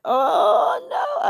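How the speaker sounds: loud, high drawn-out cry; retold outburst, soaring way up high; excited exclamation. Driver spotting production gear before a U-turn, excited cry.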